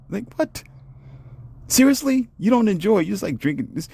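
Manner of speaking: high-pitched disbelief; incredulous. Real, not acted. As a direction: squeak out in shock. Incredulous at five-minute men.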